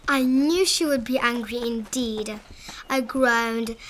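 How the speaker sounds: usual speaking voice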